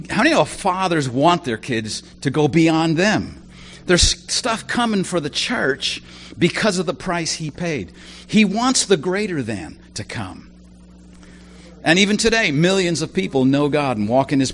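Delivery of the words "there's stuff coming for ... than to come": normal talking voice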